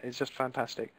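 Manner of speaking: ordinary talk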